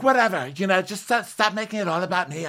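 high, exasperated mock brush-off; exasperated dismissal. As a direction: wave them all off. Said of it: "Lisa's like".

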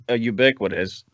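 ordinary talk, nothing marked

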